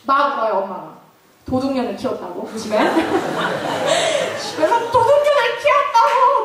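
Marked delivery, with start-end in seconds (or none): 4.4-6.4 mock loud wailing. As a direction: wail it so the whole house hears. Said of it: "막 들으라고 막 울어요". (들으라는 듯 높게 울부짖는 엄마 흉내)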